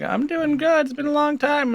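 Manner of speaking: high-pitched friendly reply; acted polite small talk. Visitor humouring forgetful great grandma, acted high reply.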